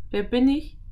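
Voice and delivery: high rising question; riddling girl. A narrator's reading.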